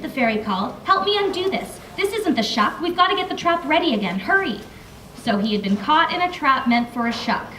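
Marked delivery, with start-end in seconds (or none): 0.8-4.6 urgent call. Acted. Fairy calling urgently to hummingbird.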